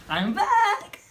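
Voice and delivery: high, loud drawn-out call; playful announcement. Real, not acted. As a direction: sing it out on returning to the camera. Calling out on returning to camera.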